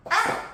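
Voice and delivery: high, sharp ow; squeaky acted ouch; mock pain yelp. Acting out the strained oblique.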